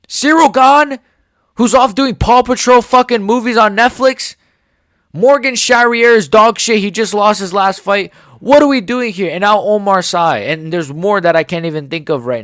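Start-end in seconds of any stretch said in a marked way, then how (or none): 0.1-9.3 real anger at French fighters; angry rant. Real, not acted. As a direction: vent the outrage at each name. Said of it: "I'm so angry".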